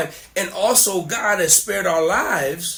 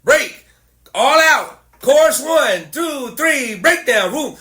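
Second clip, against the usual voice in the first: loud, high called-out commands; punchy prompts like a cue track; click-track cue voice; 5 semitones higher, 5 dB louder, slower than usual. Retold or imitated. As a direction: call out band cues one by one. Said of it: "where it tells you".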